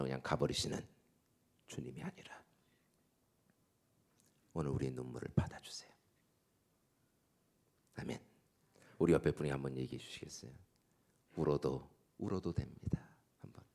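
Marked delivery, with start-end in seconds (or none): 1.7-8.2 hushed, tender whisper; whispered prayer. Acted. Pastor leading the congregation in quiet prayer, whispered. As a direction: pray it softly as if to a father.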